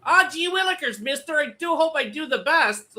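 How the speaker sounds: put-on high, eager little kid voice; wholesome 1950s kid. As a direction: gush like an earnest young kid. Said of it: "she sounds like she's, like, eight years old".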